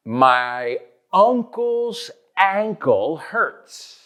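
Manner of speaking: slow, high uncle's ankle, UN versus ANG; drawn-out "uncle's" then "ankle" with a cat vowel; careful vowel contrast. Shown as a demonstration. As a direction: keep uncle's and ankle clearly apart, very slowly. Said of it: "be careful with that second word".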